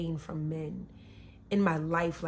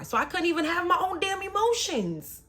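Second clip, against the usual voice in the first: high, loud exasperated protest; indignant outburst; 12 semitones higher, 6 dB louder than usual. Real, not acted. Woman forbidden to cry by her ex, indignant.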